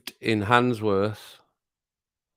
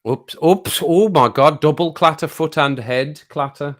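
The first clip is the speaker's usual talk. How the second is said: loud, raised-pitch real alarm; startled exclamation; 5 semitones higher, 6 dB louder than usual. Real, not acted. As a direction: burst out at the sudden clash. Reacting to a clash in the game.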